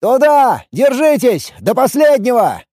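high, urgent commander's call; shouted battle orders. A narrator's reading. (командный выкрик в бою)

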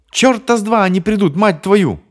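very high, loud furious shout; furious yelling. Acted. Bonnie yells that rescue won't come, furious. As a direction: spit it back at him. Bonnie screams back at Paul.

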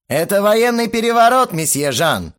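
high, loud mock-menacing yell; threatening shout. A narrator's reading. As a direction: announce a coup with menace. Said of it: "угрожающим тоном прокричал я".

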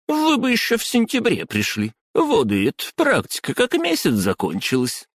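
high squeaky scolding woman. Acted. Clerk scolds him.

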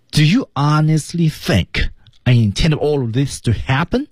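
slow, high, stressing "honestly", "think", "intended", "happen"; stressed key words. Shown as a demonstration. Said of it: "关键词就这么几个点啊". (慢速重读"honestly"、"think"、"intended"、"happen")

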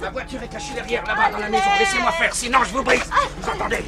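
high, loud, agitated threat; menacing shout. Acted. Captor forcing her to the car, menacing shout.

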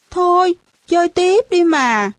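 put-on high, coaxing little-kid voice; pleading child. A narrator's reading. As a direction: beg a playmate not to quit. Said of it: "đợi cho nó năn nỉ cả chục lần".

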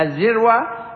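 "الذروة" with a short i on the first letter; kasra pronunciation. Shown as a demonstration. Teacher showing students the word's variants, kasra on "الذروة", dhirwa. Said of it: "وهي مثلثة بكسر الدال".

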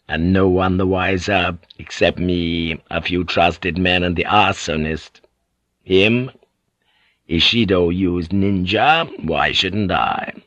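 ordinary delivery

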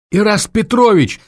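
high squeal of joy. A narrator's reading. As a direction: shriek with joy. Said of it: "взвизгнула Варя".

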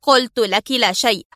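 curt, cutting reply; sharp interruption. A narrator's reading. The husband cutting off his worried wife, sharply. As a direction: snap her off. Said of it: "قاطعها في حدة".

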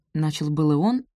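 ordinary delivery, nothing marked

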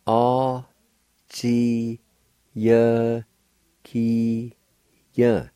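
"A, ji, ye, ki, ye" one syllable at a time; long, separated syllables in "A, ji, ye, ki, ye"; sounded out. Shown as a demonstration. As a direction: pause after every syllable of "A, ji, ye, ki, ye". Teacher sounds out word for captive.

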